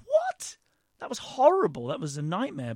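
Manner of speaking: mock outrage, pitch leaping way up on What; astonished disbelief. Student stunned by the panel's praise, incredulous. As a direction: squeal the What, then protest. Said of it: "And I was like".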